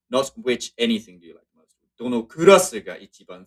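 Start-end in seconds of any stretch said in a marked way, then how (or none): 2.0-3.4 loud punch on "kurasu"; stress on kurasu. Shown as a demonstration. Teacher demonstrating emphasis on the class, stressed "kurasu". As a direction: lean hard on "kurasu" before ga. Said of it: "putting the emphasis once again on the class".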